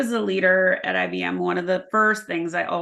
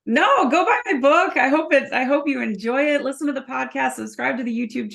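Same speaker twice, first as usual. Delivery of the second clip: much higher and louder, eager sign-off; excited plug; 7 semitones higher, 4 dB louder than usual. Real, not acted. Guest plugs her book to the host, excited. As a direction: burst into a cheerful sales pitch.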